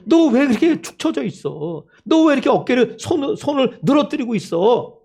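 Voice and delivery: high-pitched insistent pleading; urgent exhortation. Acted. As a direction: urge a disheartened friend to look up. Acted rebuke of the discouraged. (높은 목소리로 다그치듯 격려)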